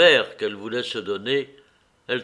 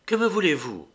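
a dry, cold question, pitched higher; curt; 6 semitones higher than usual. A narrator's reading. Wife coldly confronting her guilty husband, dry and curt. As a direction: snap it out coldly, keeping him at a distance. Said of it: "demanda-t-elle sèchement".

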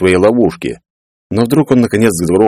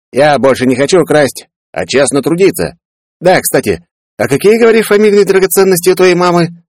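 much higher, sly greedy question; sly Peller; 10 semitones higher than usual. Acted.

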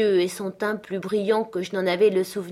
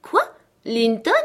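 higher, louder astonished question; joyful surprise; 5 semitones higher, 6 dB louder than usual. A narrator's reading. Katy hearing her cousin's name, joyfully surprised. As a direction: burst out in happy disbelief. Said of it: "s'illumina d'une surprise joyeuse".

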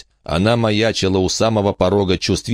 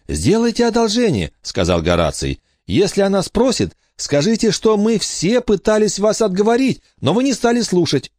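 put-on much higher boyish voice asking a favour; pleading boy Horace. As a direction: beg them to cover for him.

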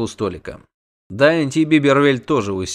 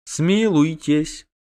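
slow, high plea for mercy; heart-rending wail; 6 semitones higher, much slower than usual. A narrator's reading. Doppler begs captors for mercy, wailing. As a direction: draw out the plea pitifully. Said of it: "душераздирающе завопил Доплер".

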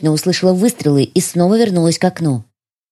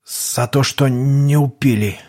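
frightened spy; 2 semitones lower than usual. Acted. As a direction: plead with a killer. Шпион Янис, низкий чужой голос. (испуганный шпион)

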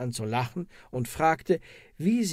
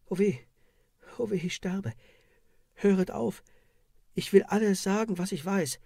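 breaking under pain; 6 semitones higher than usual. Acted. Woman breaks under torture.

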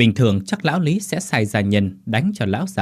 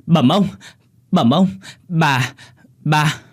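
higher and louder, breathless alarm; panicked cry; 6 semitones higher, 5 dB louder, slower than usual. Acted. Servant Mõ bursts in on master Lý, frantic cry. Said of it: "la lên thất thanh".

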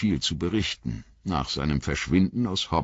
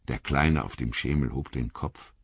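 usual speaking voice

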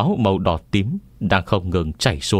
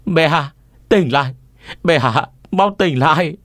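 high, loud urgent call; 9 semitones higher, 4 dB louder than usual. A narrator's reading. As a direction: call him awake, much higher. Eunuch calling emperor to wake.

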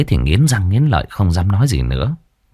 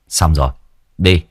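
much lower, curt scolding voice; gruff young exorcist; 5 semitones lower than usual. A narrator's reading. Exorcist scolding his friend to shut up, low curt voice. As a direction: bark it down at the whiner. Đen speaks.

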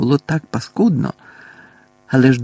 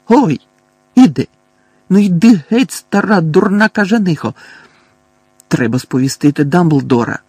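higher, louder yelps under blows; yelping protest; 4 semitones higher, 5 dB louder than usual. A narrator's reading. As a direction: cry out as the bag hits you. Mundungus beaten with a bag.

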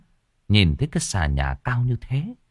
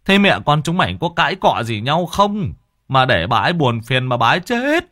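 scolding; 6 semitones higher, 5 dB louder than usual. Acted. Elder scolding questions.

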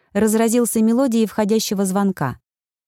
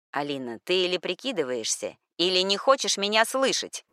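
рычит; 8 dB quieter than usual. A narrator's reading. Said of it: "Муж уже рычал в трубку".

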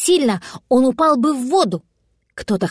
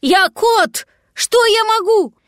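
high-pitched indignant protest; 11 semitones higher, 3 dB louder, slower than usual. A narrator's reading. Cat protesting the demand to rescue, high indignation. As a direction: protest your helplessness, pitched way up. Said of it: "возмутился кот".

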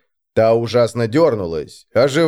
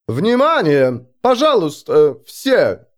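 raised, drawn-out public call; calling for attention; 5 semitones higher, slower than usual. A narrator's reading. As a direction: call out to hush a crowd. Baron calls crowd to attention.

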